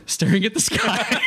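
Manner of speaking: high, drawn-out words through laughter; amused telling, voice lifted by laughter; laughing. Real, not acted. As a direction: crack up while finishing the phrase. Laughs while telling own mishap.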